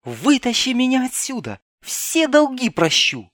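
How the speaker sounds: put-on high, urgent girl's plea; excited young woman. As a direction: beg to be pulled out, thrilled. Girl in cage begs rescue. (взволнованная девушка)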